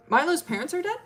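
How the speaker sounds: high-pitched, rising disbelief on DEAD; stunned question. Acted. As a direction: echo the news as a shocked question. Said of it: "And she's like".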